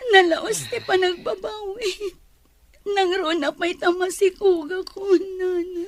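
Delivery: high, tearful pleading; crying. Acted. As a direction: sob the words out in regret. Daughter regretting before her mother. (agsangsangit nga agbabawi)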